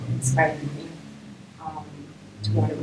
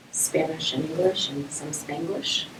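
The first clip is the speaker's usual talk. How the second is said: normal talking voice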